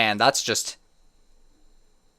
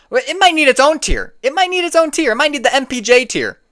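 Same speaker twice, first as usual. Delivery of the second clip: heated mockery; 10 semitones higher, 4 dB louder than usual. Real, not acted. Mocking the take's tier.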